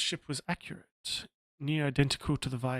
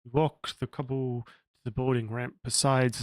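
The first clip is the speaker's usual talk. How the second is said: normal talking voice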